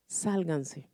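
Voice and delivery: soft, low, distant call; hushed unseen voice; quiet mysterious voice. Retold or imitated. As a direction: murmur it like a voice from nowhere. Mysterious voice heard while praying.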